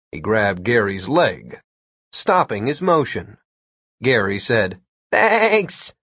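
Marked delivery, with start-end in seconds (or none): 5.1-5.9 high, bleating voice held on one note; wavering whine. A narrator's reading. Gary thanking Tyler while still vibrating, goat-like waver. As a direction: bleat it out while being shaken.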